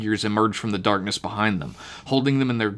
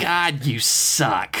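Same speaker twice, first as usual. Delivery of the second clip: slow, drawn-out mild groan of disgust; exasperated groan; 3 semitones higher, much slower than usual. A narrator's reading. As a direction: groan it slowly at a hopeless companion. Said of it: "Myrtle groaned".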